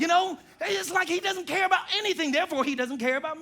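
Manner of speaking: high, insistent mock grievance; complaining spouse. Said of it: "I've heard spouses complain about that".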